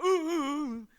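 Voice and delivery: high, loud mock hoots; exaggerated "ooh" noises, imitating someone; imitated hooting. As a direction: hoot it out mockingly. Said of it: "'cause you go".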